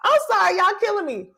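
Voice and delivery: high, tickled, just out of laughter; real amusement. Real, not acted. Host to viewers after their joke, high and amused.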